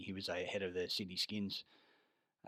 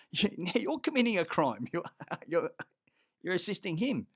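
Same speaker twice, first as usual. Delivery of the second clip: high and loud, laughing through it; mock-outraged yell at the grabber; outraged accusation; 9 semitones higher, 6 dB louder than usual. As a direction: accuse him, half laughing. Retold argument, laughing.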